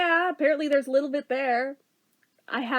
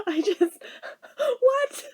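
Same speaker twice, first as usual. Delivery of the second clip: incredulous laugh; 8 semitones higher, much slower than usual. Real, not acted. Laughs at doppelganger.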